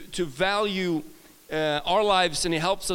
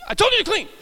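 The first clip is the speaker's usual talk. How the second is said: mom scolding; 14 semitones higher, 5 dB louder, faster than usual. Retold or imitated. Mom voiced in a story.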